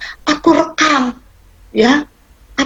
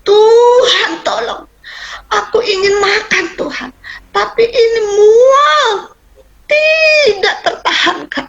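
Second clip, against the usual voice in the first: retold cry for help; 9 semitones higher, slower than usual. Sick patient crying to God for help, pleading shout. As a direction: cry out in desperation, stretching the key words. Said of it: "saya sampai teriak".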